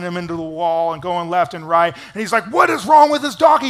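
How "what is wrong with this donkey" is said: loud, high acted exasperation; mad at donkey. Balaam run into the wall by his donkey, acted anger. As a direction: burst out in mock outrage. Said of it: "he's all mad at his donkey".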